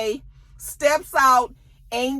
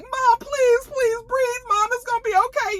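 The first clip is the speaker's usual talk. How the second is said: very high mock-tearful pleading; imitated screaming crying; 14 semitones higher than usual. Kids come in to their dying mom, imitated screaming and crying. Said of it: "they all screaming and crying like".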